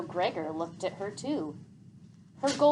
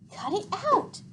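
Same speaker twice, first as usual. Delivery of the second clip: snap; 13 semitones higher, 3 dB louder than usual. A narrator's reading. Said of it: "he snapped at her".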